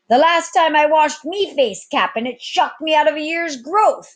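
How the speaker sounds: high, loud rough cook's voice; laughing cook. Acted. Cook Baho joking to the captain, high and loud. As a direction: joke back at the captain. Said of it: "the cook, laughing".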